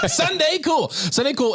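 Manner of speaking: high-pitched hammed-up brand plug; mock sponsor plug. Answering the host's fake ad break, high mock plug. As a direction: shout out the sponsor in a silly high voice.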